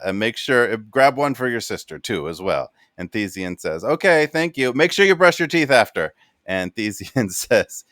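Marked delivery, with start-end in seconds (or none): 3.8-6.1 mom voice. Acted. Parent character voiced by GM.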